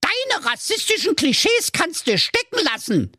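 put-on high, offended Berlin-accent snap; hissing angrily. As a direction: bite it off in indignation. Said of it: "zischte der Ninja".

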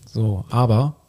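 ordinary talk, nothing marked

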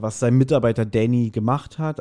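usual speaking voice

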